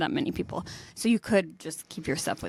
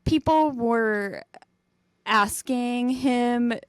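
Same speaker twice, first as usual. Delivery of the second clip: ordinary delivery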